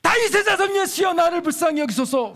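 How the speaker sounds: 목청껏 높이 외치는 간청; 군중 너머로 부르짖어 도움을 청하듯. Shown as a demonstration. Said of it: "소리를 질러". (큰 소리로 부르짖는 외침)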